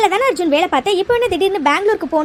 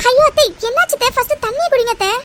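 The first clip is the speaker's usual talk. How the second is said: high, worried plea; alarmed urging; 5 semitones higher than usual. Acted. Daughter-in-law urging aunt to drink water, alarmed. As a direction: urge her anxiously.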